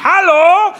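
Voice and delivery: high, loud hello; loud call. Retold or imitated. Wife on a bad mobile line, loud hello. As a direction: shout into the phone.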